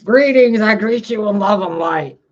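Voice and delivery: mock Yeshua channeling. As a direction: boom it out like a fake holy messenger. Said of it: "That's not how Jesus talks".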